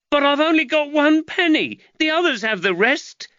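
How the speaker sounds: put-on protesting boy. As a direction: whine back at your mother. Nathaniel pleading.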